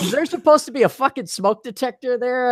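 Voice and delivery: high, mock-incredulous fan. Mocking a super chat fan asking about the smoke detector, high incredulous voice. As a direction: ham up the nosy viewer's question.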